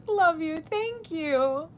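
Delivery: normal talking voice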